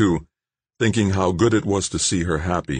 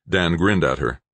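ordinary delivery, nothing marked

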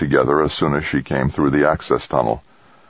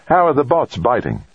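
cheerful; 8 semitones higher than usual. A narrator's reading. Woman teasing colleague over a video call, cheerful. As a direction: ask it cheerily in a higher woman's voice. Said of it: "she said cheerfully".

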